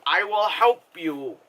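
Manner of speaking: mock German accent cracking into laughter; hammed-up German, laughing by the end. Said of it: "it was a stereotypical German accent".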